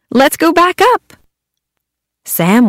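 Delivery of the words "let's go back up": high eager call; excited. Acted. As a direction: bounce with excitement. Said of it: "said the kids".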